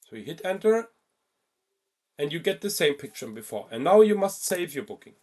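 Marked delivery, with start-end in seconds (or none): none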